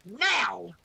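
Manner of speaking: sharp, loud snarled command. A narrator's reading. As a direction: snarl it to make him flinch. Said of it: "Meryl snarled".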